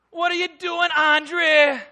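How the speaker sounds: loud, high scolding in an imitated Bajan accent. Frustrated grandmother scolding her grandson, loud imitated Bajan accent. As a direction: holler it like an exasperated Bajan grandmother. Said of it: "she would say in her Bayesian accent".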